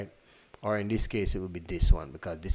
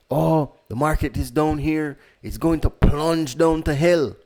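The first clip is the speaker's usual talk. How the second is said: alarmist doomsayer voice; mock panic about a crash; panicky doom voice; 6 semitones higher, 7 dB louder than usual. Mocking panicked people.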